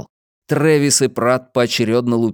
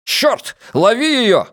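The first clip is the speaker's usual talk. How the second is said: high, urgent shouted order; cursing, high-pitched yell; 11 semitones higher, 3 dB louder, slower than usual. A narrator's reading. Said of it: "заорал Трэвису Пратт".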